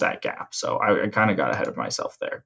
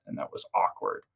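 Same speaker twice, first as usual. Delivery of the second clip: whispering; 11 dB quieter than usual. Retold or imitated. Cringing at an awkward memory.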